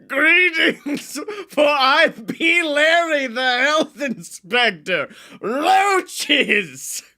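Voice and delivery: high, slow, booming herald voice; grand proclamation. Retold or imitated. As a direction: announce yourself like a pompous herald. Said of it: "He walked in and was like".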